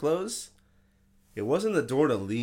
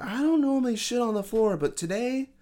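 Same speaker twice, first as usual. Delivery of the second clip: very high-pitched mock confession; imitating tweaker; 13 semitones higher than usual. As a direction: put on a squeaky tweaker voice. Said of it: "like, tweaking".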